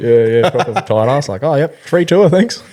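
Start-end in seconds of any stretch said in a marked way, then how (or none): 1.4-2.6 higher, cheeky mock thanks; mock grateful freeloader. Backpacker mimicking his tight ass self, cheeky and higher. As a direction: play the sneaky freeloader pretending innocence.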